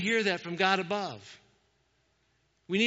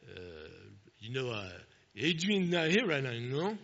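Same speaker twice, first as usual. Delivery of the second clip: bad Rocky impression; 2 semitones lower than usual. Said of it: "I can't, really can't do Rocky".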